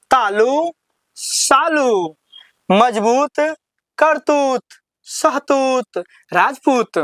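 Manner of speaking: call and repeat drill. Acted. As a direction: call each word for the class to echo. Leads class drill.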